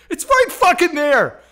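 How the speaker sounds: loud, high, exasperated accusation; angry cop shout. Retold or imitated. Cop shining his light on the back seat, furious. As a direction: imitate an officer yelling at a caught liar.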